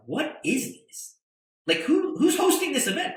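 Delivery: high, disbelieving questions; incredulous. Retold or imitated. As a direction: play the puzzled bigwig, pitch climbing. Retold bigwigs puzzled at crowd.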